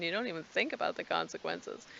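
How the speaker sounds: ordinary talk, nothing marked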